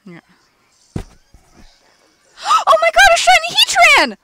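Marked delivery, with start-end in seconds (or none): 2.7-4.2 shrieking jackpot winner. Real, not acted. Finds a shiny Heatran.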